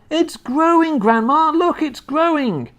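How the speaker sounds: very high-pitched excited calling; excited boy's yell. A narrator's reading. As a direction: cry out to Grandma to look. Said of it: "he yelled".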